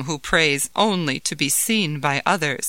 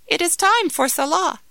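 wife voice; 7 semitones higher than usual. Acted. Wife urging husband to pray.

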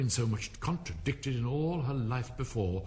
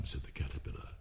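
usual speaking voice

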